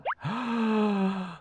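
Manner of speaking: high, drawn-out gasp of surprise; long shocked gasp. Real, not acted. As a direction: gasp at an astonishing find. Discovers a golden egg.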